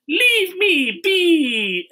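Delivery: high, very slow drawn-out mock plea; hammed-up boy's retort to his nanny. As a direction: stretch it out like a put-upon kid. Boy retort in retold scene.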